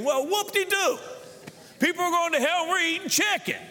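high sarcastic exclamation; sarcastic scoff. Acted. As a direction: sneer at the chicken dinners in a high voice. Mocking a pastor's empty visits.

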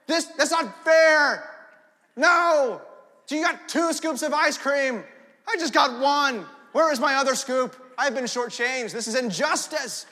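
put-on loud, slow, whining kid over ice cream; whiny kid complaint. Kid at home whining to a parent about ice cream, loud and whiny. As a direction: whine like a shortchanged child. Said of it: "What do you hear?".

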